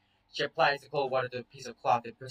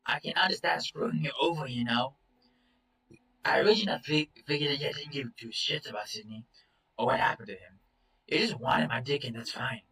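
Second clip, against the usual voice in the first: angry. Acted. Accusing Chase heatedly.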